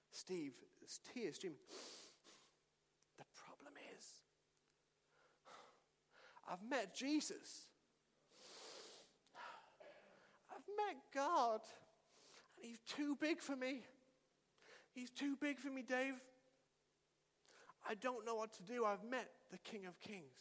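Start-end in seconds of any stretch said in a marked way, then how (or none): 3.2-4.1 breathy hushed confession; barely voiced, like a secret too big to say; whisper. Steve whispers his confession to the pastor. 6.5-20.4 overwhelmed tearful student. Retold or imitated. Tearful student tells pastor he met God, softly overwhelmed. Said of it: "And he's crying and crying".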